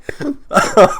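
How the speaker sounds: high delighted chuckle; joyful laugh. Real, not acted. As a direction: laugh it out happily. Laughing with joy.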